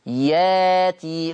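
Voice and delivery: ياتي with a long held aa and no glottal stop; hamza turned to alif. Shown as a demonstration. Teacher tells class how it is said, ياتي drawn out without hamza. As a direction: replace the catch with a slow, stretched vowel in ياتي. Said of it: "خلي بالكم من نطقي".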